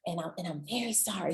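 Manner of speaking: quick, soft apology; softly acted regret; sincere apology. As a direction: drop to a hushed, contrite tone. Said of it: "I just need you to say".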